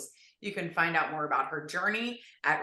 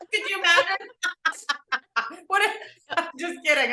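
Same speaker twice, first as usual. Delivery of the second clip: laughing; 10 semitones higher, faster than usual. Real, not acted. Joking about testimonial.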